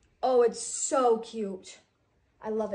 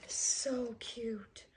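awed whisper; 6 dB quieter than usual. Real, not acted. Kid gushing over a new bag.

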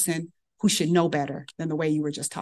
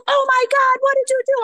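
high, loud, thrilled question; delighted surprise; 5 dB louder than usual. Retold or imitated. Senior resident thrilled on the phone, high and loud. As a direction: gush in disbelief.

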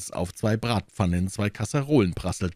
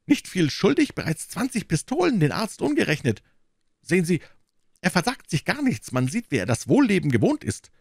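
high, fretful innkeeper voice, wringing his hands; anxious innkeeper; 9 semitones higher than usual. Acted. Innkeeper pleading with D'Artagnan, high and anxious. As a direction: play the worried host complaining.

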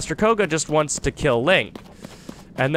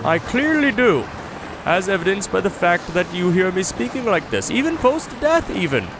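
higher, theatrical evil-cultist voice; mock-sinister cultist monologue, a touch higher; hammed-up Yiga villain; 6 semitones higher than usual. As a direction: ham up a cheerfully evil villain reveal. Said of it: "I don't know why I speak like this".

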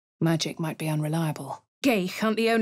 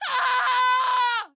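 high, loud yelp; sharp cry of pain; 4 dB louder than usual. Acted. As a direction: cry out as if struck. Sudden cry in battle.

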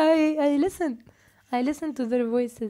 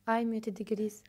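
softer whispered aside; 4 semitones lower, 7 dB quieter than usual. Real, not acted. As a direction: murmur it under your breath. Aside while moderating stream.

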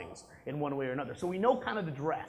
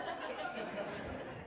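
breathy laugh; 13 dB quieter than usual. Real, not acted. Laugh after quoted refusal.

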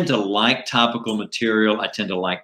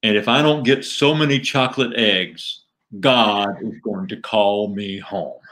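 rabbit puppet's mock-solemn televangelist threat; Norman. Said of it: "He goes".

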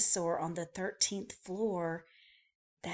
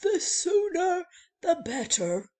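weary guest; 10 semitones higher, 8 dB louder than usual. Acted. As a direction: sigh it out like an exhausted traveler. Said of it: "said Mister Snore".

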